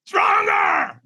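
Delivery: high, full-throated scream of Stronger!. Retold or imitated. Trainer screaming at the hesitant firewalker, a high yell. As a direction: yell it to jolt him into a peak state. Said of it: "He screams at me".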